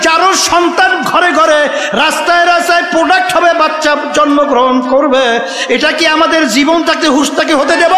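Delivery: high, forceful rallying sermon; impassioned preaching. Real, not acted. Preacher challenges congregation over their children, in high impassioned preaching. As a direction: build to a shouted challenge to the crowd.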